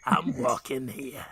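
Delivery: gruff low New York voice; slow tough-guy New York drawl; New York accent. Acted. Quote of famous movie line.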